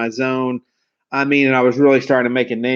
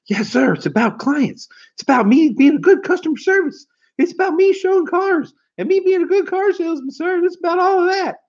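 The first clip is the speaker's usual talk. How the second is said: put-on much higher-pitched, earnest young salesman rattling off answers; eager nervous kid. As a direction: overeager rookie trying to impress the boss. Nervous young self answering boss.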